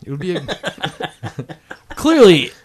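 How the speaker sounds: loud, slow, laughing exclamation. Real, not acted. Co-host capping the bomb diaper joke, laughing loudly. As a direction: draw out "clearly" loudly through laughter.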